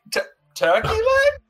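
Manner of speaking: high-pitched, shouting; excited shriek. Acted. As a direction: yelp it out in delight. Character lured by turkey leg.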